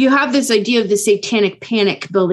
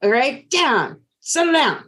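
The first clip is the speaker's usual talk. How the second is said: higher-pitched mock yell of a mother; retold mom yelling; 6 semitones higher than usual. Mom snapping at noisy kids at dinner, mock yell. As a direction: imitate the mom hollering at the kids. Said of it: "Louise starts screaming at the kids".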